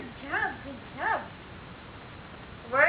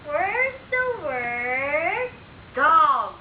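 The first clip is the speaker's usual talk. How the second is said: long, sing-song teaching question; drawn out like a game show reveal; slow drawn-out question; 5 dB louder, much slower than usual. Shown as a demonstration. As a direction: stretch every word out slowly for a toddler. Parent drills child on word cards.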